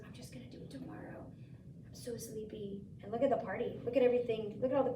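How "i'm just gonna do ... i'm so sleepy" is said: soft, breathy sleepy murmur; a hint of drowsy iguana. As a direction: mumble it like you're half asleep. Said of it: "Iguana was so tired".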